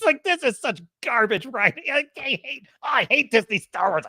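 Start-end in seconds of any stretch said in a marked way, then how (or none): none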